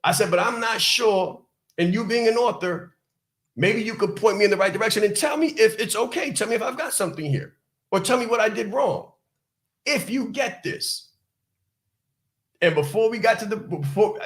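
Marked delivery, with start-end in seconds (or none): 0.3-11.1 higher, humble asking; a modest plea for a favour; humble request. Retold or imitated. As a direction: ask humbly, like a hopeful beginner. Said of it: "I said it with humility".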